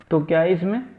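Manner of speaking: normal talking voice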